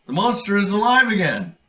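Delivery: high, loud alarmed shout; kids crying out. Acted. Kits shouting in fright that the monster lives. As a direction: cry out that the monster's back.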